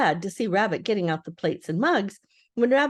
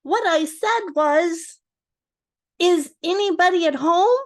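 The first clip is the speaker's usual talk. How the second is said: high, loud drawn-out call; calling very loudly; 9 semitones higher, 5 dB louder than usual. Acted. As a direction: shout it down the rabbit hole. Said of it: "called out Pooh very loudly".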